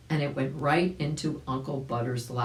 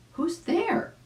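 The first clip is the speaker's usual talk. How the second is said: much higher chick voice; chick asking. Acted. Arabella asking who knocked, much higher chick voice. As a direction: call through the door curiously. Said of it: "asked Arabella Chick".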